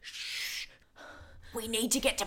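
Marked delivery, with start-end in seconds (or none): 0.0-0.7 hushed warning whisper; whispering a warning while hiding from pursuers. Acted. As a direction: breathe it so they don't hear.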